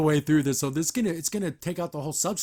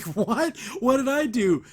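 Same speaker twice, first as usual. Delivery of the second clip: higher, louder mock bewilderment; startled protest; 6 semitones higher, 4 dB louder, slower than usual. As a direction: protest as if wrongly accused. Hop Pop learns they'll kill him.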